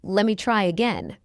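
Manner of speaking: quick, linked "Let me try again"; fast English. Shown as a demonstration. Teacher trains learners' ears, fast. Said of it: "fast English is not difficult".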